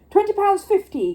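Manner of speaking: high, loud bid; calling out a bid. Acted. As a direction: call the bid across the room. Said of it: "called Mister Gruber".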